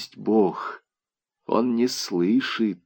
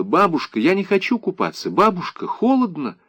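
put-on high-pitched little girl's plea; tearful child; 8 semitones higher than usual. A narrator's reading. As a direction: plead with grandmother like a crying child. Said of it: "Девочка плакала". (жалобный высокий голос плачущей девочки)

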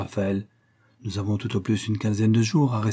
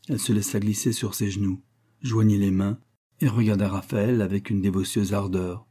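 ordinary delivery

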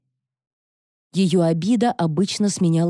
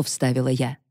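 ordinary delivery

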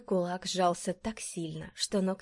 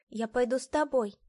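put-on higher little boy's voice; Айдар; 6 semitones higher, 3 dB louder than usual. A narrator's reading. Young son offering to go with his mother, childlike. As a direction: offer it suddenly in a child's pitch. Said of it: "неожиданно сказал Айдар".

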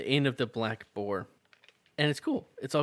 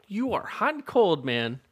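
exasperated; 8 semitones higher, 5 dB louder than usual. Acted. Exasperated at Shaw.

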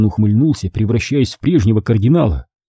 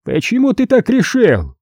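high, sudden suspicious question; 11 semitones higher than usual. A narrator's reading. Cardinal suddenly suspects the prisoner, high and wary. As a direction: probe him warily. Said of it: "подозрительно поинтересовался он".

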